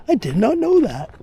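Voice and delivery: mock-formal deadpan quip; Johnny Carson catchphrase. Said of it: "I'd say".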